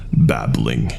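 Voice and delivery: ordinary delivery, nothing marked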